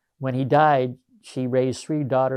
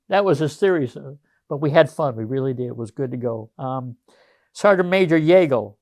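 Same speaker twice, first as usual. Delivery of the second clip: ordinary talk, nothing marked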